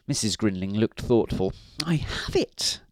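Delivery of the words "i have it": high wifely eureka; sudden high-pitched realisation; exclaimed. A narrator's reading. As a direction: exclaim it in a woman's voice. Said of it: "she exclaimed".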